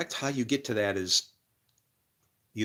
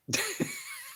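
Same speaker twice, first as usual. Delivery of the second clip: squeaky high laugh; 6 dB quieter than usual. Acted. After mocking the resisting listener.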